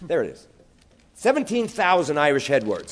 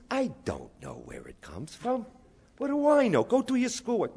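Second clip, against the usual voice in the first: dismissive mother; 7 semitones higher, 5 dB quieter than usual. Retold or imitated. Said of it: "She says".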